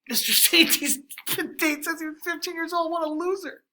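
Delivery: high, taunting kid voice mocking the teacher; mocking gossip. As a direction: sneer it like a gossiping student. Teacher voicing a gossiping student.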